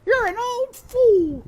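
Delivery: high, loud, slow self-scolding cook; cook scolding herself. A narrator's reading. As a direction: chide yourself in the cook's high voice. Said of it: "she said to herself".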